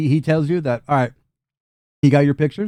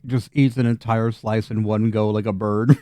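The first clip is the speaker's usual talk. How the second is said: usual speaking voice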